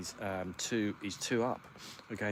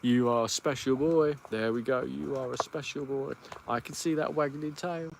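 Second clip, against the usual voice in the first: higher, cooing pet voice; 4 semitones higher than usual. Real, not acted. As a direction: coo at the dog like a proud owner. Cooing praise to his dog.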